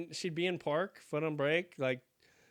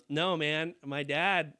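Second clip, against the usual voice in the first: ordinary delivery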